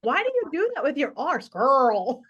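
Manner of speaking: high, loud mock-Scottish complaint, then exaggerated American squirrel; Scottish husband overdoing squirrel's R. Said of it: "And he's like".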